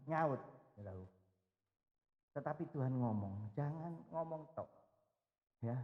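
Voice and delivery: normal talking voice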